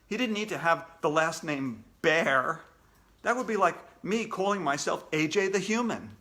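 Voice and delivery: ordinary delivery, nothing marked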